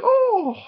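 high, loud yelp of pain; mock pained groan. Man kneed in the groin, mock pained yelp. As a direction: yelp like you just got kneed.

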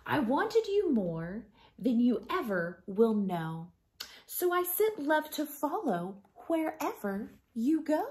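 tender picture book reading; reading to children. Acted. Teacher reads picture book aloud.

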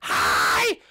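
shrieked hello, loud and drawn out; Jenny screaming an over-eager hello; screaming greeting. Acted. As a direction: yell your name right in his face. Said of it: "there's no need to scream".

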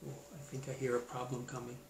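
ordinary delivery

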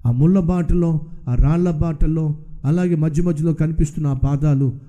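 God telling the believer the footprints are not his. Acted. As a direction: speak low as the Lord revealing the truth. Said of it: "దేవుడు అంటున్నాడు".

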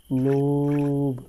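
very slow, drawn-out jeer; mocking taunt. Real, not acted. Gamer jeering at Granny for her mistake, long and mocking. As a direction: stretch it out to mock her.

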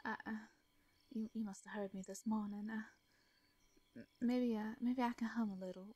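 ordinary delivery

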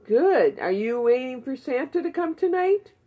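ordinary delivery

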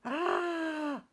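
high, shouted fish scream; Stanley faces a shark, high shriek; little fish shriek. Acted. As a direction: shriek in terror as tiny Stanley. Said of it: "shrieked Stanley".

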